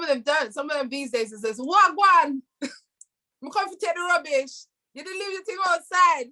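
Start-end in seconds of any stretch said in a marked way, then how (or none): 1.6-6.3 high-pitched, hammed-up dustman calling out; dustman caricature. Dustman telling a householder about the rubbish, hammed-up high call. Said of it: "not all dustmen talk like that".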